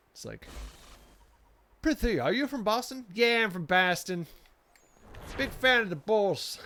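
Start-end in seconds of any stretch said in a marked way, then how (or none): 1.8-3.1 lightly acted high, loud game-character question; a hint of a raised, old-timey questioner; mock Boston role-play. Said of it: "like, a modern Boston accent". 3.1-6.6 put-on loud, higher-pitched Boston accent, slipping into Chicago; mock Boston accent. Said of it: "like, a modern Boston accent".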